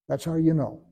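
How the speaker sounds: ordinary delivery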